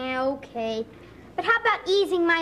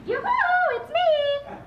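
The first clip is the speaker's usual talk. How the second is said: high sing-song yoo-hoo; girly falsetto call; 10 semitones higher, slower than usual. Acted. Boy in girl disguise calls out.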